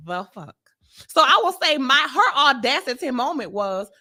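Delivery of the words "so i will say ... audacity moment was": ordinary talk, nothing marked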